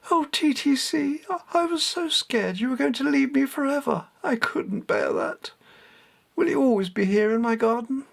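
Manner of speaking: put-on high, anxious boy's voice; boy afraid his friend will leave; young boy, pleading. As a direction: beg like a frightened child. Boy Richard pleads with cat.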